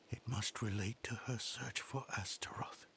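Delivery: whispered thought to herself. Acted. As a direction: muse it in a whisper.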